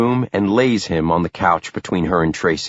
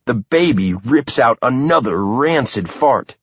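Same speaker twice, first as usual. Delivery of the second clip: plain reading voice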